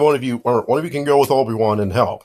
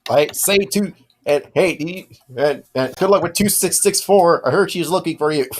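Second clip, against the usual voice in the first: higher, chirpy droid; 5 semitones higher than usual. Acted. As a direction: pitch it up like a cheeky droid. Droid voice bantering with the party.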